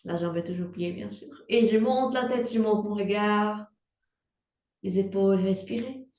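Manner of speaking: usual speaking voice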